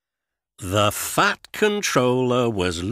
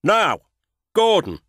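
high and louder, stern summons; stern call; 11 semitones higher, 6 dB louder than usual. A narrator's reading. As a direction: call Gordon to order. Fat Controller scolds Gordon.

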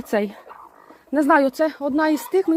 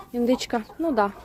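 normal talking voice

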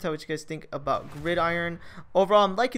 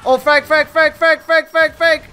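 loud, high repeated frag callout; grenade warning yell; 9 semitones higher, 13 dB louder, slower than usual. Real, not acted. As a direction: yell it before the blast. Grenade incoming, warning team.

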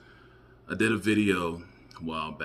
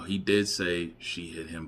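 ordinary delivery

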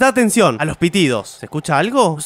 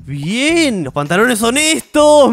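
ordinary talk

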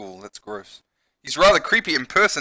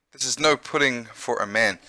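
ordinary talk, nothing marked

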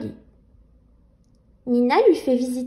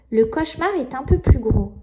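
plain reading voice

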